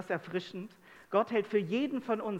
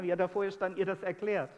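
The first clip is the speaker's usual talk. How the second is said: normal talking voice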